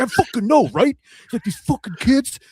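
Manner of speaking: hammed-up aggrieved Jay; mock indignant agreement. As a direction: whine along in fake outrage.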